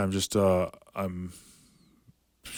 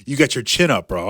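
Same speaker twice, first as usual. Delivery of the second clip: higher and louder, a mock ref barking at him; mock ref scolding; 5 semitones higher, 6 dB louder than usual. Ref scolding the fighter about his chin, mock raised voice.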